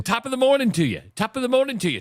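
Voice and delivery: high-pitched, badly faked Irish lilt; bad Irish accent. Host repeating his catchphrase to co-host, bad Irish accent. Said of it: "I can't do an Irish accent".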